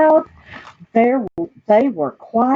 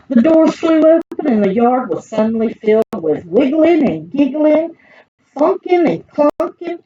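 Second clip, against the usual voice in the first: high, rising sing-song list; excited storytelling; 7 semitones higher than usual. A narrator's reading. Reader describes the yard bursting into recess, excited. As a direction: build the bustle with pitched-up excitement.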